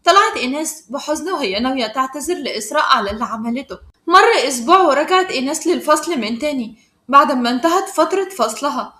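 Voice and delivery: plain reading voice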